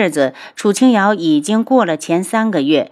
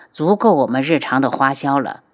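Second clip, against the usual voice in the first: plain reading voice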